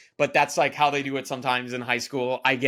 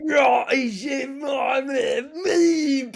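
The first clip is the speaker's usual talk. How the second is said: Cockney line howled much higher and louder with a mock cry; hammed-up Cockney wail; 10 semitones higher, 6 dB louder, much slower than usual. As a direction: overact the Cockney ending, wailing and crying. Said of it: "horrible Cockney accent".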